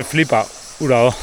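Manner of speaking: ordinary talk, nothing marked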